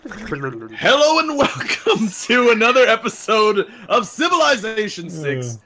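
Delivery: show host intro. Acted. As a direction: open the show with big host energy. Host performs the episode intro.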